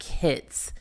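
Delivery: usual speaking voice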